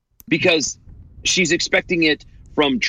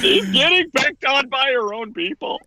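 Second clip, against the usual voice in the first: high, teary mock sob; fake-blubber the line; snotty crying; 8 semitones higher than usual. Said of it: "if you start crying".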